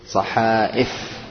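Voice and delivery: صحائف drawn out slowly with the hamza; slow teacher model; slow careful model. Shown as a demonstration. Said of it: "الهمزة الحرف المستعمل الملفوظ".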